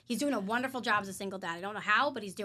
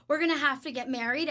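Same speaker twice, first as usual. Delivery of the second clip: loud; 5 semitones higher, 7 dB louder, faster than usual. Retold or imitated. Repeating it to stunned Dave.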